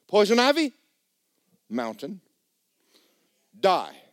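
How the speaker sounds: loud, high command; commanding rebuke. Retold or imitated. Preacher commanding his daughter's poison ivy to die, loud. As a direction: order the sickness to die.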